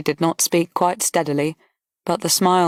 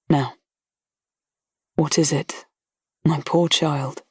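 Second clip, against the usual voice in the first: tender; 3 semitones lower, slower than usual. A narrator's reading. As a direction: soothe a crying girl. Colonel comforting gently.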